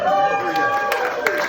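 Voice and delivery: long high whoop, loud and slow; cheering call. Real, not acted. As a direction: whoop the crowd up. Cheering on bridesmaids.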